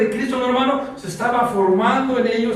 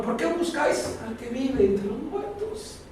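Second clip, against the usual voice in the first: gentle angels' question; 4 semitones higher, 7 dB quieter than usual. Acted. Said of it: "Así fue la pregunta".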